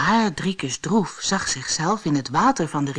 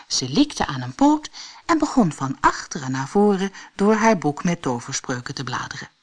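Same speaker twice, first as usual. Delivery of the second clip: plain reading voice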